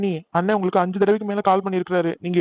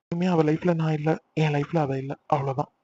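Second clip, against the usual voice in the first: resigned finality; 4 semitones lower than usual. Acted. Man telling friend he is done with her, resigned. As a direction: let it go with heavy resignation.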